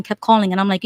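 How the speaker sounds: ordinary talk, nothing marked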